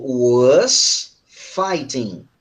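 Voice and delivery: very slow, stretched-out words; slow correction. Shown as a demonstration. Teacher corrects a student's wrong verb form, slow correction. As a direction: model it word by word.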